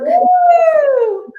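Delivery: loud, high whoop of joy; excited cheer. Real, not acted. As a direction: cheer them in. Cheering the guests' return.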